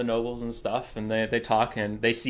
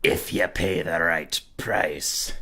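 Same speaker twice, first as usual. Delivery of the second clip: put-on mysterious old man; 10 semitones lower than usual. Old man tempts the wanderer, low and hushed. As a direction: murmur it like a cryptic hermit.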